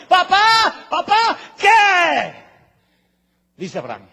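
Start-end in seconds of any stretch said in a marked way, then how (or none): 0.1-2.3 put-on high, loud child's desperate cry. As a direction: call out to papa from the altar, desperate. Isaac calls from the altar.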